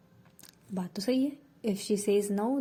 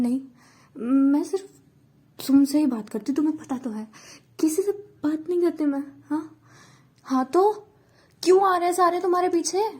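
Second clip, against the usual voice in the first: heated argument; 5 semitones higher, 6 dB louder than usual. Acted.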